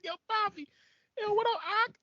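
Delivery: squeaky muppet ordering a sandwich; Elmo at a bodega. Acted. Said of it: "Elmo ordering at a bodega".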